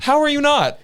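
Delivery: high, loud disbelieving cry; indignant shrieked repeat of the question; incredulous. Real, not acted. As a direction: throw the question back in disbelief. Repeating the retort with force.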